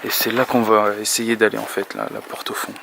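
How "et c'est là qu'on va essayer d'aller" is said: normal talking voice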